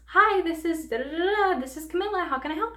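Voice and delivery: bright, high customer-service voice, a touch put on; sing-song shop greeting, imitated lightly; chirpy phone greeting. Coworker answering the store phone, mock-sweet customer-service voice.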